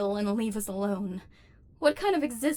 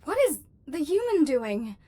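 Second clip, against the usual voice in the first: alarmed robot; 4 semitones higher than usual. Acted. Human examines the robot.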